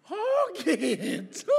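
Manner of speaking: loud, high, drawn-out mock outrage; hammed-up inner exclamation; inner retort. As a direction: stretch it out as an indignant comeback. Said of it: "Dalam hati".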